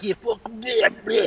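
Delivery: hammed-up fit of anger; mock anger. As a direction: sound like a mook losing it. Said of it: "When I really anger at somebody".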